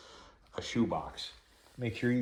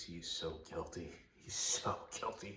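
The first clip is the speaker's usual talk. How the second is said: hushed aside; 3 semitones lower, 11 dB quieter than usual. Real, not acted. Quiet aside on guilty dog.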